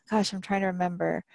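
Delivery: usual speaking voice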